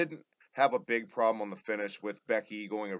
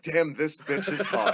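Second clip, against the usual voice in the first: laughing quote; 5 semitones higher, 4 dB louder, slower than usual. Retold or imitated. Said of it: "that's literally what the thought".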